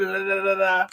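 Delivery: repeated syllables tumbling out and fading; imitated stutter. Vlogger showing viewers how they stumbled in videos, imitated stutter. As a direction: mimic getting stuck on a syllable. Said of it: "like, stuttering".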